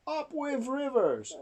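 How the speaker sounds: high, cheering storybook chant; hooting dinosaurs. A narrator's reading. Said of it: "And they hooted".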